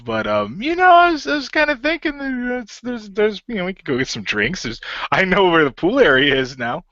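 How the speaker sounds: high, loud nervous pickup voice; nervous pickup line with pitch breaking upward; awkward flirting. Acted. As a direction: stammer through the flirt, voice cracking up high. David awkwardly hits on the hologram.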